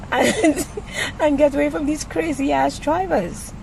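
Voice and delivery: high, laughing exasperation. Real, not acted. Griping to camera about crazy drivers, laughing. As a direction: laugh through the complaint, pitch up.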